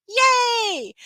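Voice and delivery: high, loud drawn-out yay; playful cheer. Acted. Mom celebrating baby sleeping through class, playful high cheer.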